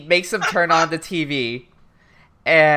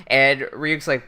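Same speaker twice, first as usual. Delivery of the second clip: usual speaking voice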